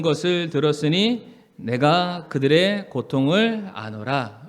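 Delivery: slow, measured read-aloud of scripture. Acted. Pastor leading the congregation in a verse, scripture reading. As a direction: read it out slowly for all to follow.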